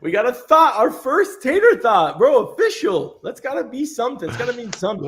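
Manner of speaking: high-pitched, thrilled celebration; giddy hype, pitched well up; real excitement. Real, not acted. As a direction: announce it like a big win. Celebrating first Tater Thought.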